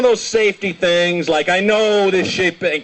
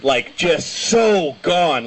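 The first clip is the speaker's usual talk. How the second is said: normal talking voice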